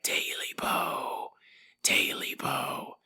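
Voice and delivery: very soft, slow whispered chant; eerie whisper. Acted. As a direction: whisper it like a haunting creature. Cat creature at foot of bed.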